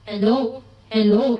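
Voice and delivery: loud mic check through speaker; mic test. Shown as a demonstration. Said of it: "Original sound mode".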